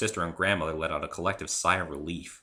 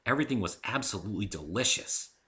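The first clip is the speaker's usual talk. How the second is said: usual speaking voice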